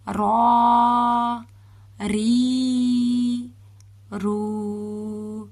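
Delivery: را full and heavy, ری thin and light, رو rounded. Shown as a demonstration. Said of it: "را کو را پڑھتے وقت پر کریں گے". (را پر اور ری باریک)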